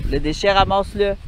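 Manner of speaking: normal talking voice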